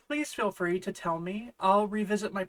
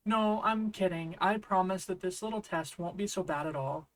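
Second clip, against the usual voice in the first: usual speaking voice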